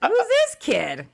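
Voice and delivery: put-on slow, high puzzled old lady asking who the kid is; playing the grandma who forgot her granddaughter; confused grandma voice. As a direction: squint at a stranger and ask, drawn out.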